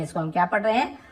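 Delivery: ordinary talk, nothing marked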